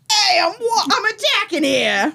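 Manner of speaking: hammed-up New York accent, laughing through it; a laughing mock New York tough yelling back; bad New York accent. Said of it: "bad New York accent".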